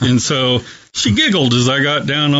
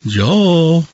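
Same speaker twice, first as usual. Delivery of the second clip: imitating a sweet English lady, higher and lilting; sweet English accent; 4 semitones higher, slower than usual. Chaplain voicing his English patient phoning him, sweet English accent. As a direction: lift the pitch steadily into an eager English-accented invitation. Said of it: "In her sweet English accent, she said".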